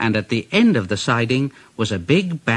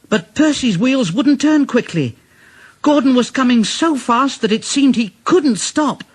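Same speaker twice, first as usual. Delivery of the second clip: ordinary delivery, nothing marked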